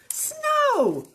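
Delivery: high, loud cry of discovery; storybook cow amazed at the sight; excited exclamation. A narrator's reading. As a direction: shout it with wonder. Character excitedly spots falling feathers.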